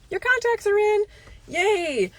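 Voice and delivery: high, loud chirpy phone voice; mock cheery caller. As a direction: chirp the news like a sing-song receptionist. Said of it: "So I get the call".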